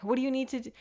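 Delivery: chirpy offers of help; eager helpers. Retold or imitated. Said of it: "everyone's like".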